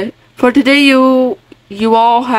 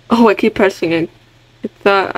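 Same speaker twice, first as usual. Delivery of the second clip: usual speaking voice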